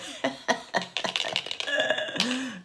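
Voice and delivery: airy little laugh; quick giggle; high breathy giggle. Real, not acted. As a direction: laugh to yourself, high and light. Laughs at her old chestnut.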